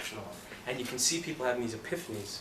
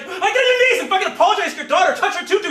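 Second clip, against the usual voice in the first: high, full-volume tirade; furious screamed demand; 15 dB louder than usual. Retold or imitated. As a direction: scream as if forcing an apology. Acted furious order at actor.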